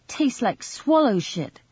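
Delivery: put-on high, slow disgusted girl voice; girl's voice. A narrator's reading. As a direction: spit it out like a child in disgust. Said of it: "she said".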